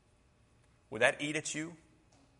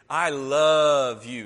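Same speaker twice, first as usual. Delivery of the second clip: slow, drawn-out and very loud; tender to a grandkid; 10 dB louder, much slower than usual. Shown as a demonstration. Grandfather telling his little grandkid, loud tender drawl. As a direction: stretch it out lovingly. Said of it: "talked to your little grandkid".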